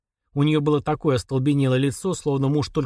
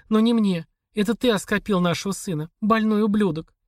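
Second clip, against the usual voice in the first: a hint of a higher, pleading wife's voice; lightly voiced wife Olga; 7 semitones higher than usual. A narrator's reading. Wife demanding a divorce from her husband, lightly voiced. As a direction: read her lines a little higher, worn out and insistent. Olga's voice in the dialogue.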